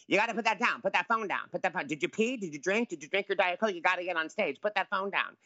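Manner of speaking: high, rapid-fire nagging dresser; imitating the dresser hurrying him to the stage; impression of the dresser. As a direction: play the fussing dresser, well above your own pitch. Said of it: "he talks like this".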